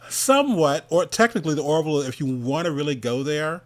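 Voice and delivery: amused and loud; loud, laughing aside. Real, not acted. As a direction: blurt it out between laughs.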